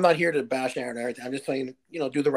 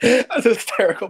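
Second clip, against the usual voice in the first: 1 laughing; 7 semitones higher, 7 dB louder, slower than usual. Real, not acted. Host reacts laughing.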